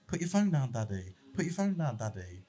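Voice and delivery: put-on a small child begging for attention; imitating his young child. Toddler nagging dad to put phone down, high child voice. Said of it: "if your kid has to keep saying to you".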